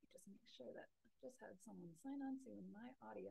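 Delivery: hushed aside, off-mic; whispered aside. Real, not acted. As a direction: murmur it under your breath. Aside while fixing audio.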